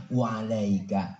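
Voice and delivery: low, slow, unruffled answer; calm low reply. Acted. The Prophet answering the curse, calm and low. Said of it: "balikin aja".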